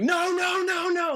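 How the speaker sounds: yelling at the screen in dread; retold panicked shout. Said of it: "I was only like".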